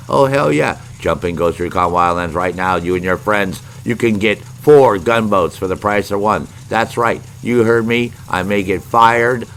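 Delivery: loud hard-sell car-lot ad voice; mock commercial hawking free gunboats; infomercial pitch. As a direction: sell it like a pushy TV salesman. Fake boat sales pitch.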